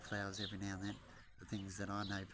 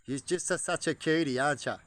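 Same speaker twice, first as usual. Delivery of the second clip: cooing at a pet; 6 semitones higher, 11 dB louder than usual. Real, not acted. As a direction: coo at the hen like a pet. Cooing at a chicken.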